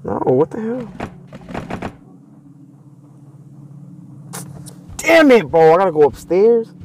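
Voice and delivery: high, startled yelp; freaked out and frustrated; scared horror game streamer. Real, not acted. Startled by monster.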